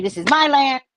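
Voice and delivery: mock claim. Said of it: "the Palestinians will say".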